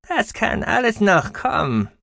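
very high squeaky voice, gloomy. A narrator's reading. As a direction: darkly warn in a high squeak. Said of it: "gab Gucki düster zurück".

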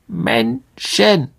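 high, syllables split apart; clicking spider. A narrator's reading. A spider reporting its catch to the elder, high clicking voice. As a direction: click the word out in two high bursts. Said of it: "klickte die Spinne".

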